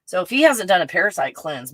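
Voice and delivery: ordinary talk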